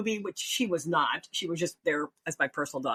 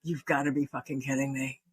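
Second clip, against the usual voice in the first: deadpan disbelief; 6 semitones lower than usual. Retold or imitated. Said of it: "the look on their face was like".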